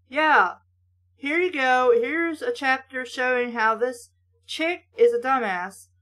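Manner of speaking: usual speaking voice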